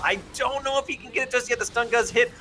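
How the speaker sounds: rising, fast hype call; excited play-by-play. Real, not acted. Caster calls a team fight.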